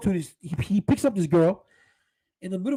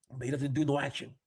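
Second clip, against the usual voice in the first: ordinary talk, nothing marked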